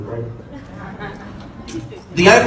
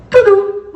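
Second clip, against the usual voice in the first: beep noise; 3 dB louder than usual. Retold or imitated. Phone beep sound.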